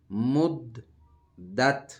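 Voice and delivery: مد then دت, separate loud syllables, high; teacher breaking مدت apart; broken recitation. Shown as a demonstration. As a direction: split مد دت into two clipped syllables. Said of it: "یہ توڑ ہو گیا".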